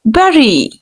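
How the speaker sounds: bury said "berry"; correct bury. Shown as a demonstration. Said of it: "you will have to pronounce U S E".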